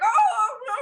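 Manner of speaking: high-pitched, hammed-up weeping; breaking down in tears. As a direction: wail like an overcome cartoon character. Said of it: "he breaks down in tears of oil".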